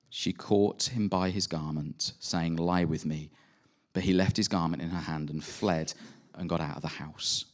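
scripture reading. Acted. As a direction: read the passage steadily, like a lesson from the pulpit. Reads the Genesis passage aloud.

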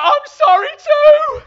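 high, drawn-out yell back; screamed apology. Retold or imitated. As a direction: scream it as if about to die. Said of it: "just scream".

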